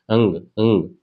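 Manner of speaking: "अंग" as a nasal ङ from throat and nose together; drawn-out nasal "अंग", repeated as a model; throat-and-nose sound. Shown as a demonstration. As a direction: slowly hum "अंग" through the nose from the throat. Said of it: "कंठ से निकालो और नाक से निकालो".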